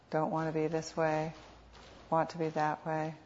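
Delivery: unhurried meditation guide, long pauses between phrases; guided meditation. Real, not acted. Teacher guiding sitters to notice what is happening, calm meditation voice. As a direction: lead them gently, phrase by phrase, leaving space.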